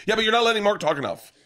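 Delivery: high-pitched mock protest; imitated complaint. Co-host scolding the podcast's host, high mock protest.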